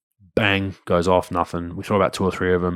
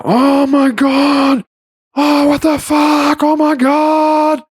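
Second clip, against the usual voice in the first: very high, slow, loud mock shriek; imitated horrified scream; 6 dB louder, much slower than usual. Boy discovering poo in his sink, hammed-up scream. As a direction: shriek it out like a kid who just found a disaster. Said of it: "like, yelling and screaming and losing his shit".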